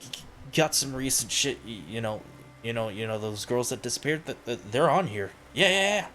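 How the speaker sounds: a twitchy addict sidling up to sell; jittery junkie dealer. Acted.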